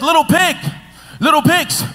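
big bad wolf calling at the door. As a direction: coax the pigs like a hungry wolf. Wolf voiced again.

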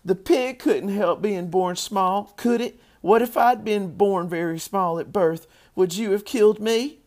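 high, loud pleading argument; crying out. A narrator's reading. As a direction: plead as an upset little girl. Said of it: "cried Fern".

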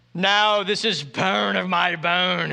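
put-on slow, theatrical English accent. Adam reciting his poem to the woman, in an English accent. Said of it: "doing this with the English accent".

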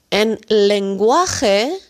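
each word drawn out slowly for learners; slow teaching phrase. Shown as a demonstration. Teacher to Dutch learners, slow and deliberate. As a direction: spell out "en lenguaje más sencillo" slowly. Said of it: "En sencillo betekent eenvoudig".

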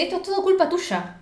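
a hint of an angry girlfriend; blaming. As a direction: throw the blame at her. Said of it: "se lo dice así".

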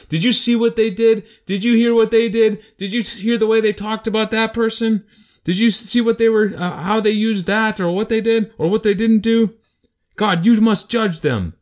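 high, loud prosecutor's whine against the saints; accusing devil. Acted. The devil accusing God's people in the courtroom, high and loud. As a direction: plead the case like a sneering accuser before a judge. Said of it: "always accusing the".

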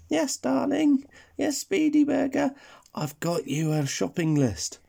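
high little granny voice; sweet granny voice. Acted. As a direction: speak like a doting old granny. Granny voice in the story.

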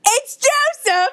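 high, slow, excited reveal; excited announcement. Acted. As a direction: call out the name gleefully. Caller introduces himself.

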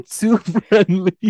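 words breaking into laughter; laughing. Real, not acted. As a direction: try to finish through a real giggle. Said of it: "You guys, I'm laughing".

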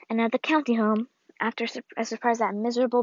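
plain reading voice

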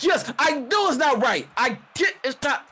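high, worked-up outburst; agitated agreement. Acted. Wrestler answers the protesting crowd, high and agitated. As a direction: call back over a protesting crowd. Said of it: "And she's like".

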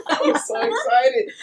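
laughing, high and slow with excitement; bubbling over, giggling through it; barely contained excitement. Real, not acted. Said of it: "I can barely contain my excitement".

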